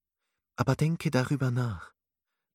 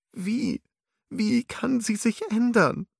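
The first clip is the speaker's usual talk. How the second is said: very high, louder sobbing question; 12 semitones higher, 4 dB louder than usual. A narrator's reading. Alice pleading with Lyria between sobs. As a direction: sob the question out. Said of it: "fragte Alice schluchzend".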